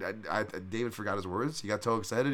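ordinary talk

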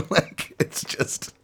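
laughing breathlessly; high laugh. Real, not acted. Laughing at his own impression.

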